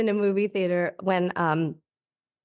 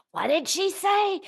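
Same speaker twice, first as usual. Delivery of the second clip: high-pitched heckling question; audience member; 10 semitones higher than usual. Retold or imitated. Audience member asking mid-play about a missed line, high mock query. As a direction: pipe up high, imitating a confused spectator. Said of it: "on stage where someone's like".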